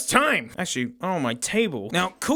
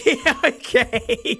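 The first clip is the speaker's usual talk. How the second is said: laughing; 3 semitones higher, 4 dB louder, much slower than usual. Real, not acted. Laughing while reading.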